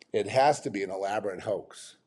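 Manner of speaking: ordinary delivery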